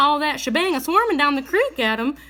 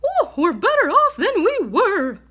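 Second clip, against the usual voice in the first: chickens; 6 semitones higher, 3 dB louder than usual. A narrator's reading. Chickens answering the old woman.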